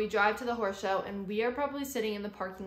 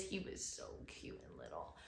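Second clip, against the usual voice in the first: whispered, much lower and softer; soft cooing whisper; 16 dB quieter than usual. Real, not acted. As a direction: melt over a cute puppy. Seeing the puppy.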